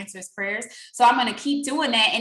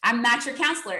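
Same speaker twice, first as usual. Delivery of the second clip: ordinary delivery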